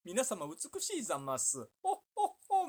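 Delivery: posh madam laugh, drawn out; rich madam voice. Retold or imitated. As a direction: play a haughty rich lady. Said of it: "みたいな".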